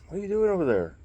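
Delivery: loud, high call across the room; real call out. Real, not acted. Calls out to someone nearby.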